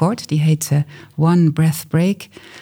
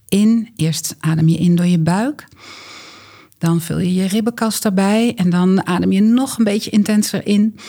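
calm raised coaching voice; guided breathing; 5 semitones higher than usual. Acted. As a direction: lead them through each breath.

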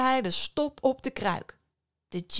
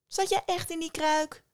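high, quick sly fisherman; crafty fisherman, pitched up and brisk; 8 semitones higher than usual. A narrator's reading. Fisherman questions djinn. (sluwe, hoge vissersstem)

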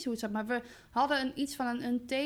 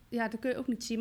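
ordinary delivery

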